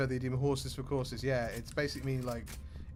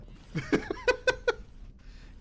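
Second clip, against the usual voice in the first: a sudden, much higher cackling laugh; high burst of laughter. Real, not acted. Laughing at the idea of learning Brummie. Laughing at own accent joke.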